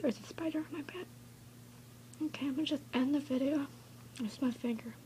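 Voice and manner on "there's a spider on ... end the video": scared whisper. Real, not acted. Sees spider on bed.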